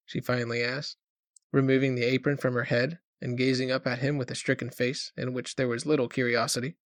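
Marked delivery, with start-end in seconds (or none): none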